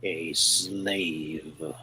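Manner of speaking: slow, low alien voice; drawn out, low and menacing. Acted. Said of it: "this strange sort of alien type voice".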